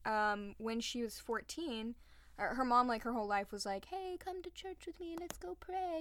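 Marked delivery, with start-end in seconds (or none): 3.8-6.0 high, soft imitation of a pious mother; mom's voice. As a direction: sweetly coax her daughter to church. Mom's pleading voice in retold story.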